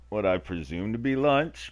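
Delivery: normal talking voice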